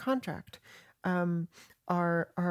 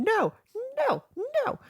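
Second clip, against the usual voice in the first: mock screaming. Retelling Ben being arrested, mock screaming no. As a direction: wail it like a petulant child dragged off. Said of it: "He's screaming".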